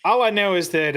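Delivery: usual speaking voice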